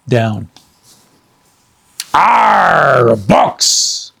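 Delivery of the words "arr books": loud disgusted pirate growl; Captain Bones growling at the news. Acted. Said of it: "growled Captain Bones".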